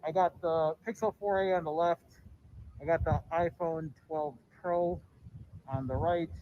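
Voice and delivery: muffled behind a face mask; muffled through mask. Real, not acted. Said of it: "so my voice is all muffled".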